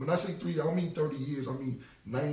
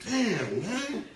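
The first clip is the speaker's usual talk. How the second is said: laughing plea; 7 semitones higher, 6 dB louder, much slower than usual. Retold or imitated. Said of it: "I'll be like".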